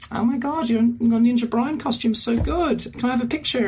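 high, gushing fan voice; imitated fan girl. Girl asking a cosplayer for a picture, high imitated gushing. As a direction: gush in a high put-on girl's voice.

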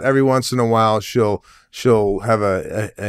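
normal talking voice

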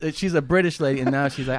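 ordinary delivery

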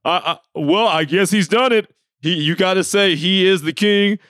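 lightly voiced commentator conceding defeat; a hint of a grudging pundit on air; imitating Mike. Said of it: "He's like".

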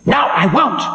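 high, ominous voice of Time; stern ancient Time. Acted. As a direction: warn the demon of doom.

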